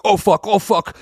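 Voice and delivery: high, loud panicked yelp; mock panic. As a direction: imitate someone caught out and panicking. Acting out addict caught by parents.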